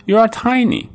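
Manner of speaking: tiny high-pitched ant voice; little ant voice. A narrator's reading. Little ant promising the farmers, in a tiny high voice. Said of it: "said ant".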